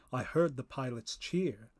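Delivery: ordinary delivery, nothing marked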